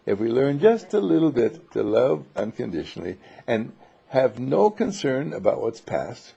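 ordinary talk, nothing marked

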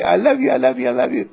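high cooing love words; cooing to a doll. Shown as a demonstration. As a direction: coo to the doll like a baby.

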